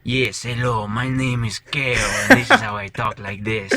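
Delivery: hammed-up Spanish accent. Player demonstrates the character's early voice, heavy Spanish accent. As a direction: play the Spanish accent big and loud. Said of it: "he was very Spanish".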